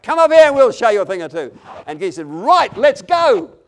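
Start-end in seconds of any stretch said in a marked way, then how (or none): 0.0-1.6 loud, high taunting challenge; shouted cry. Retold or imitated. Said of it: "and the cry went up". 2.3-3.6 loud, high battle charge; rallying shout. Retold or imitated. Retold hero charging into battle.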